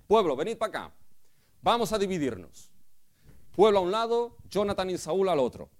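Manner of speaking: loud king giving orders to the people; commanding king. Retold or imitated. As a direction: call the people to you like a king. Saul's orders voiced by the preacher.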